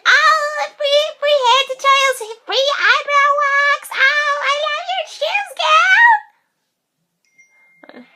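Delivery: very high, loud singsong sales pitch; mock a gushing salesperson in a squeal; pushy mall seller. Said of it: "those people who try and sell you stuff".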